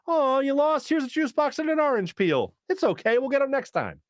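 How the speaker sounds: hammed-up comforting parent; coddling parent. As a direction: coo like an over-nurturing mom. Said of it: "as opposed to the".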